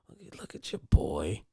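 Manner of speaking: low, soft mocking voice; teasing customer, low and quiet; mock customer jeer. Customers teasing him at delivery.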